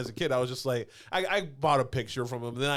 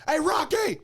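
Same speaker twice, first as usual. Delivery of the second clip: retold hyped-up hello, much higher and louder; hyped fan greeting; 5 dB louder, slower than usual. Fan meeting his childhood TV hero at Comic-Con, giddy and hyped. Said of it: "I was like, hype as shit".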